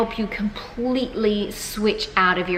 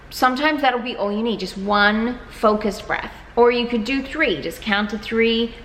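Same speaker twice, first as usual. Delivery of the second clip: normal talking voice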